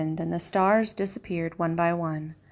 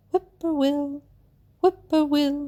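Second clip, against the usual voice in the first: higher sung birdcall; whippoorwill call; 7 semitones higher, slower than usual. A narrator's reading. A whippoorwill singing over the dark field, high sung call. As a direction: call it like the night bird. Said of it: "A whippoorwill sang out".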